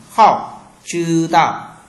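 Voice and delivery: đọc chậm "hậu, chư đạo" rõ từng âm; Chinese word read slowly. Shown as a demonstration. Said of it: "các bạn sẽ đọc qua từ mới cùng mình". (đọc mẫu chậm rãi, to rõ)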